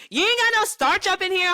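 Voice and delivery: high, loud put-on mom voice; a loud, high mother scolding. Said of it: "she'd come up and be like".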